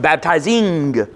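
"baptizing" slowed, the -ing stretched out; drawn-out ending. Shown as a demonstration. Preacher shows a continuous word, "baptizing" with lengthened -ing. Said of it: "that's a continuous word".

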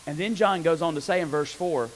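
normal talking voice

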